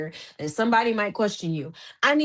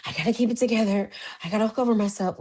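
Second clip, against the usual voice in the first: quick, lightly put-on meme voice; hint of a frantic self-pep-talk; TikTok meme imitation; much faster than usual. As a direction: rattle it off like the meme. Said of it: "you know that, um, TikTok".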